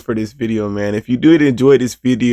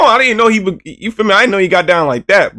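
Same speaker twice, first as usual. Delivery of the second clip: excited disbelief; 8 semitones higher, 4 dB louder than usual. Real, not acted. Excited reaction to highlight.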